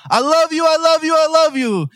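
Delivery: high, pleading repeated "I love you"; frantic dad. Retold or imitated. Dad freaking out in hospital bed.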